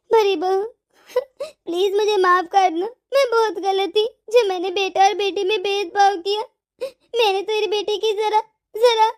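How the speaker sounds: sobbing plea for forgiveness; acted weepy, halting apology; tearful remorse. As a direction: beg through tears, breaking between phrases. Weeping apology to daughter-in-law.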